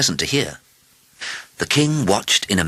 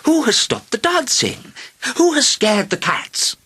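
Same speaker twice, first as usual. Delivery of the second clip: put-on very high, indignant old lady; scolding grandmother, voice pitched up; old lady crying out. A narrator's reading. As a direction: demand answers in a slow, high cry. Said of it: "she cried".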